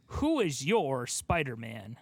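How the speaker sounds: usual speaking voice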